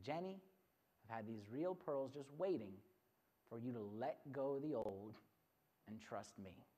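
low, hushed fatherly explanation; tender dad. Acted. Dad giving daughter real pearls, tender and hushed. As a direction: speak softly, pausing between phrases.

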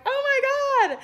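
high squeal of delight; thrilled oh my God. Retold or imitated. Influencer praises her niche, she squeals with delight. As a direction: gush it, overjoyed.

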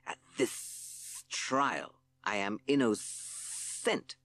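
slow hissing snake voice; hissed. Acted. Hissing Sid protests to the court in a slow snake hiss. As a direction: stretch the s sounds like a snake. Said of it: "hissed Hissing Sid".

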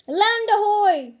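loud, slow, high squawk; a seabird crying out over the water. Acted. Snow Petrel squawks land ahoy to the penguins. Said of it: "squawked the Snow Petrel".

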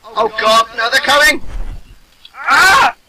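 panicked high shouting; being chased. Real, not acted. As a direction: yell in fright as they close in. Mobs chasing them.

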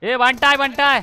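loud, high excited cheer; hyped cheer. Real, not acted.

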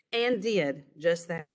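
ordinary delivery, nothing marked

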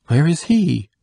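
high, loud anxious cry. Acted. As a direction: cry out the question. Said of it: "cried the duke".

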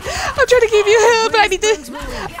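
loud pleading, climbing high into panic; frantic. Real, not acted. Healer pleading with a dying teammate, frantic and rising. Struggling to keep teammate alive.

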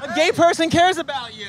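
high, excited announcement; jubilant cry. Real, not acted. Host tells John Oliver someone cares, jubilant. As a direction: announce it high, like great news.

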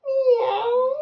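loud, very high meow; piercingly high kitty call; cat imitation. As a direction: wail it out like a cat. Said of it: "What's the cat say?".

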